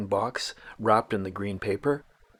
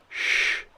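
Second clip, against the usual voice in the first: hushing shh; 3 dB quieter than usual. Real, not acted. Hushing in the forest.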